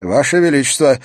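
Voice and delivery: a touch of hesitation in a formal appeal; hesitant address. A narrator's reading. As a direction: begin unsurely to a king. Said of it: "неуверенно продолжил Энтони".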